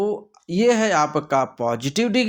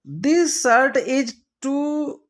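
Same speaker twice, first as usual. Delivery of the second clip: drawn-out, higher reading of the sentence; slow reading aloud; 8 semitones higher, much slower than usual. Shown as a demonstration. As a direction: read it out slowly, word by word. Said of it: "देखिए दो टू टू आ गए". (धीरे-धीरे खींचकर पढ़ना)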